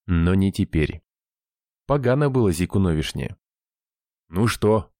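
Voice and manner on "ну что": plain reading voice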